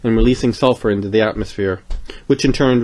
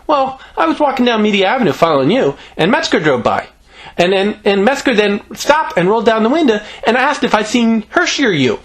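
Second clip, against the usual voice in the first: put-on high-pitched breathless-run child storytelling; chattering little girl; 10 semitones higher, 3 dB louder than usual. Greta recounts meeting Metzger, high chatty kid voice. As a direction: ramble eagerly in a high voice.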